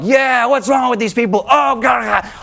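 exaggerated indignant cry of a commenter; mock outrage. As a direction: ham up the online pile-on. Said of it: "people are like".

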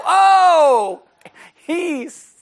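high, loud drawn-out mock gasp of dismay; loud, high mock shock; mock outrage. Mimics congregation's shocked reaction.

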